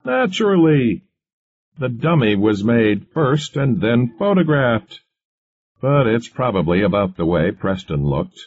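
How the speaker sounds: high, amused retort, settling as it goes; lightly scoffing, without an actual laugh. A narrator's reading. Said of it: "Moore laughed".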